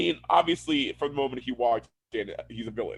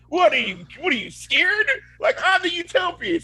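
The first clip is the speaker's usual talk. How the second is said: high, loud mock challenge; hammed-up scoffing comeback; taunting retort; 3 dB louder than usual. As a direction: act out an incredulous taunt. Acting a taunting reply.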